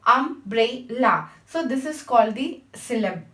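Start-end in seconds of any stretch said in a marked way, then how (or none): 0.1-1.3 "umbrella" said slowly in three separate beats, um-brel-la; syllable by syllable. Shown as a demonstration. Teacher repeating the example for students, slow and syllabic. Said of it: "thrice divided the word tomato".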